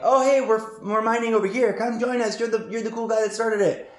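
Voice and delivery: imitating keen crewmates; eager welcome. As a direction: call out a friendly, excited invitation. Eager fleetmates welcoming him.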